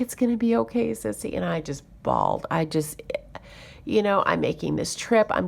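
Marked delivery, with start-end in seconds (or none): 0.0-1.3 lightly voiced kid reassuring; comforting child. Little girl comforting her.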